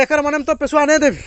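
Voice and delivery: loud, high mock dialect retort; dialect quarrel. Villager refusing money, loud mock retort. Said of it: "अपने भाषा में".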